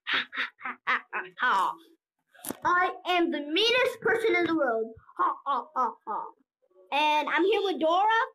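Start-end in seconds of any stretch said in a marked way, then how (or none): 0.1-1.7 low, spaced-out mock laugh; mocking fake laugh. Player jeering at the bacon man, low fake laugh. 5.2-6.2 low, deliberate fake laugh; mock villain laugh. Player boasting of being the meanest, low fake laugh.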